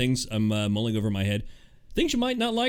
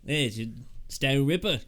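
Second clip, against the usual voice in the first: put-on Australian accent; 4 dB quieter than usual. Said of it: "That's a terrible Australian accent".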